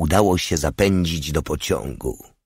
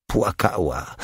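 usual speaking voice

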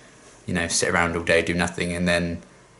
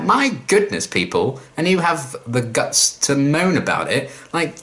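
much higher, a frustrated mock scold that slows down at the end; exasperated outburst; 6 semitones higher, slower than usual. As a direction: scold the lazy recruits, higher and slower. Exasperated rant at the potentials.

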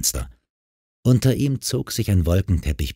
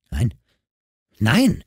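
indignant; 3 semitones higher than usual. A narrator's reading. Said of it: "entrüstete sich das junge Mädchen".

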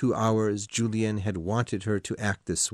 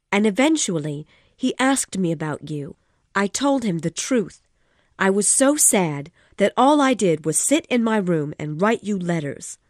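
agitated woman, raised and loud; Missus de Renal, loud and pitched high; 10 semitones higher, 5 dB louder than usual. Acted. Woman reproaching her lover, loud and upset. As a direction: pour it out, upset. Woman character voiced in story.

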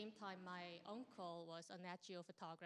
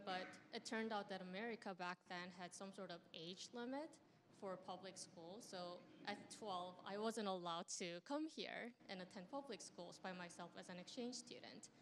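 normal talking voice

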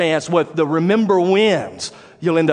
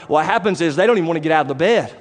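usual speaking voice

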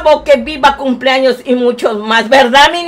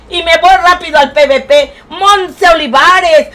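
ordinary talk, nothing marked

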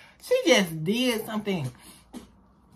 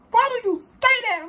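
shocked disbelief. Retold or imitated. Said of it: "I'm like".

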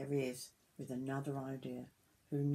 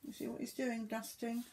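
plain reading voice

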